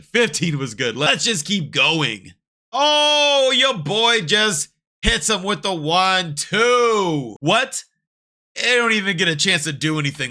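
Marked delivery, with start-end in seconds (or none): 2.7-10.3 higher, louder thrilled commentary; hyped reaction. Real, not acted. Streamer cheering the fight panel, higher loud hype. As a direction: get hyped over the big hit.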